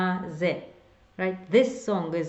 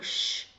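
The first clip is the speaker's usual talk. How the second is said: shushing sound. Shown as a demonstration. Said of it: "it's very easy to remember".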